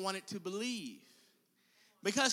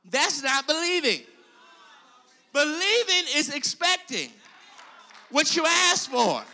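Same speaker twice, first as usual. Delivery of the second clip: loud preaching shout; 5 semitones higher, 10 dB louder than usual. Acted. Preacher correcting the congregation, loud. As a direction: shout it out as a declaration.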